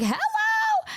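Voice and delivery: very high, squeaky hello; imitated cute greeting. Henya greeting before realizing who it is, imitated high chirp. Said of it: "Henya at first was just like".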